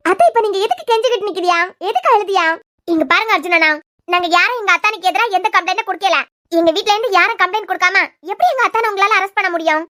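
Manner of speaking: loud, indignant defence of her family; angry protest. Acted. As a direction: shout him down on the family's behalf. Woman defends brother-in-law to officer.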